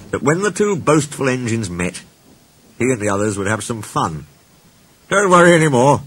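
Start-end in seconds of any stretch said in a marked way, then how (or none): 5.1-6.0 high, loud pompous assurance; self-important. A narrator's reading. Sir Handel reassuring the others about George, self-important. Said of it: "said Sir Handel importantly".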